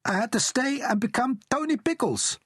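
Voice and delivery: high, bright-eyed Italian father charming his listener; Italian dad voice. A narrator's reading.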